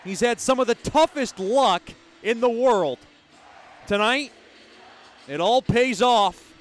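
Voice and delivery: beaming praise after the big goal; elated. Real, not acted.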